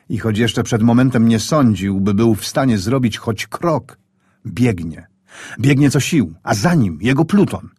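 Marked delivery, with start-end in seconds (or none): none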